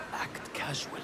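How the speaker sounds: unvoiced whisper. Informant whispering to the player to act casual. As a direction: breathe it conspiratorially.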